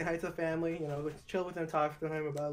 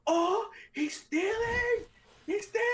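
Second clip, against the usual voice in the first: high mock yell of he's stealing; hammed-up high-pitched shout, pointing someone out; loud fake alarm in a store; slower than usual. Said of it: "I'll yell that out loud".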